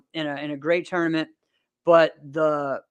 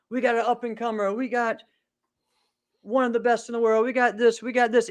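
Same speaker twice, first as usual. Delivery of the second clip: lightly voiced MC, pitched higher; imitating an announcer; 6 semitones higher than usual. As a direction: mimic an MC rattling off introductions. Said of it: "announcing all of us".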